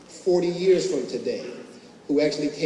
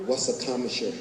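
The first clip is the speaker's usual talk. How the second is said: hushed, lower aside between students; whispered; 4 semitones lower, 5 dB quieter than usual. Retold or imitated. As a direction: ask it furtively under your breath. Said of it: "we looked at each other and whispered".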